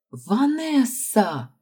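high, long drawn-out squeal of a name; delighted greeting. A narrator's reading. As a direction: stretch the name out in happy surprise. Friends greet her with delight.

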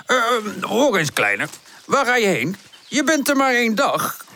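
absent-minded professor, flustered. A narrator's reading. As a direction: plead with a tiny runaway. (hoge, verbouwereerde professorstem)

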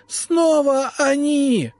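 long, high, terrified shriek; shrill shriek. A narrator's reading. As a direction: wail it out in terror. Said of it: "пронзительно завизжал ректор".

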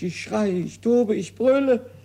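rising tantrum, ending in a roar; building to a roar. Acted. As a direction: start firm and climb to a high, loud bellow. Argan working himself up.

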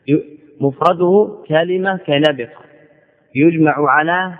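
usual speaking voice

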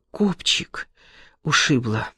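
pained whisper. Acted. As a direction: breathe it out in pain. Injured girl whispers after fall.

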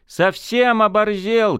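high, slow outraged cry; shouting. Acted. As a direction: cry out in disbelief. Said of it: "крикнул Костик".

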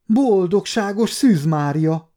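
mild shriek, high and slow; shocked shriek. A narrator's reading. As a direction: exclaim in shock, stretching the words. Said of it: "sikoltott fel".

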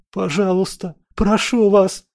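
high, tearful desperate begging; sobbing plea. Acted. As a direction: plead through near sobs. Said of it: "Парень схлипнул".

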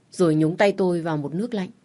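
usual speaking voice

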